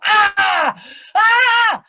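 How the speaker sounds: high, loud yell on being pushed; startled scream. Acted. Diver pushed off the board, high loud scream. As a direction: scream out as you drop. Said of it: "I push you and you go".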